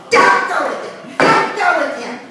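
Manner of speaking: loud, high pleading shout; mother shouting at her daughter to stay. Retold or imitated. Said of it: "My mom shouted to me".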